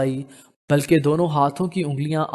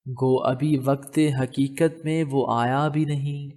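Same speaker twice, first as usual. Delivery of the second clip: poetry recitation. Acted. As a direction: lean gently into the verse like a shy reply. Narrator recites heroine's couplet.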